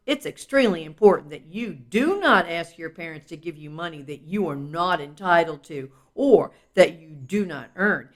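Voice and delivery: ordinary delivery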